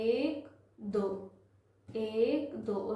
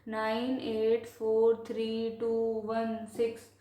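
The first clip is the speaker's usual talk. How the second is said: slow, evenly spaced string of digits; measured teacher's reading, one digit at a time; slower than usual. Shown as a demonstration. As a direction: give every digit its own beat. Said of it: "तो कैसे पढ़ते हैं".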